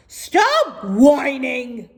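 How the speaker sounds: slow, loud telling-off; stern camel. Acted. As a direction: tell her off firmly. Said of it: "said Gloria".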